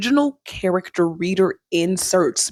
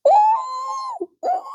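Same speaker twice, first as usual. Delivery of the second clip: very high excited ooh. Real, not acted. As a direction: squeal it out in delight. Reacting to a juicy line.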